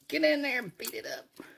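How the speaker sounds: normal talking voice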